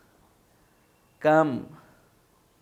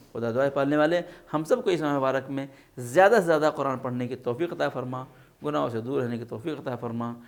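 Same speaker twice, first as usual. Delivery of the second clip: closing prayer; 4 semitones lower than usual. Real, not acted. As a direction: pray gently for the students in Ramadan. (نرم اور دھیمی آواز میں دعا)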